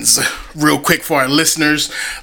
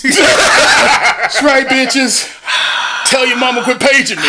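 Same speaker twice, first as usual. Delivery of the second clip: boastful shout; 8 semitones higher than usual. Acted. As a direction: brag at the crowd.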